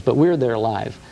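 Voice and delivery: ordinary delivery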